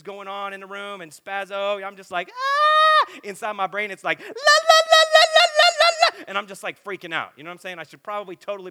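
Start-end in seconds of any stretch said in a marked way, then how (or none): 2.3-3.1 sudden piercing high scream, much louder; a wild high yelp of overload; frantic scream. Retold or imitated. Overwhelmed by noisy room. 4.2-6.2 very high, loud frantic la-la chant; hammed-up frenzied high la la la; mock brain chaos. Mimics chaos inside his brain.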